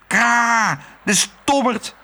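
high cawing crow cry. A narrator's reading. Crow Kwark returning, cawing in annoyance. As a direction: caw it out, exasperated. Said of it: "riep hij".

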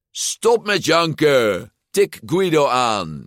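high, drawn-out bossy yell; angry shout. A narrator's reading. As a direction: bellow orders at a whining teammate. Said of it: "schreeuwde Ercole".

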